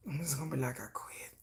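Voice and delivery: hushed whispered I quit; whispered giving up. Real, not acted. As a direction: drop to a breathy whisper. Whispered quitting.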